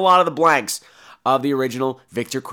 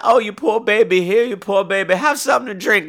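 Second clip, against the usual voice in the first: put-on old man voice; 6 semitones higher than usual. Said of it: "He's like".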